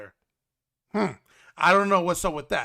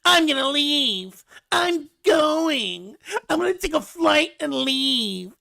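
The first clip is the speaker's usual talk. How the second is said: high, drawn-out mock whine; hammed-up complaining wail; Big Ed; 9 semitones higher than usual. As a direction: whine it like a sulking man-child. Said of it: "he's whining, he's complaining".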